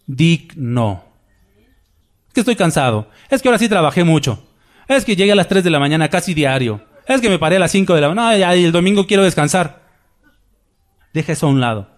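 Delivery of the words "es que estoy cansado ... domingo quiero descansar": fast, piling excuse on excuse; tired member listing excuses quickly to skip Sunday; rattled-off excuses. Pretend. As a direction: rush through the excuses like a whiny churchgoer. Preacher voices a tired churchgoer's excuses.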